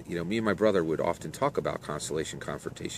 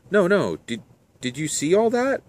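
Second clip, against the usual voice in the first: high, louder, urgent questioning; pitched-up, insistent and amazed; excited disbelief; 12 semitones higher, 6 dB louder than usual. Acted. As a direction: act out astonishment at a sudden vision. Startled Sarah asks about a vision.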